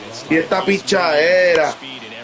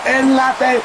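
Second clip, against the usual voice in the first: high, slow, amazed cries; awed exclaiming; 7 semitones higher, slower than usual. Real, not acted. Fan marvels at the game, high and slow. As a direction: marvel aloud, drawn out.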